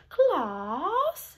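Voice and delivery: "Class" in a British accent, long open vowel. Shown as a demonstration. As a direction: call "Class" like a British teacher. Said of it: "You could do a British accent".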